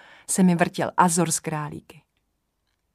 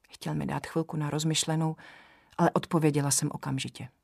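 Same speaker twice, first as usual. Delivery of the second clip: plain reading voice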